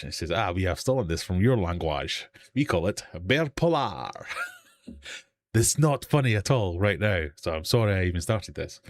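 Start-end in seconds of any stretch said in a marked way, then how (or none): 0.3-4.1 imitating a comically awful French accent; hammed-up French accent; bad French accent. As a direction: do a mock French pilot, dragging out the final word. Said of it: "He puts on the worst French accent ever".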